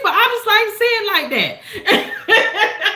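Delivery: laughing. Real, not acted. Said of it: "laughs after catchphrase".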